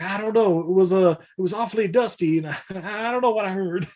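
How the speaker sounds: hammed-up doubtful grandpa; unsure grandpa. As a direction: hedge like a befuddled grandpa.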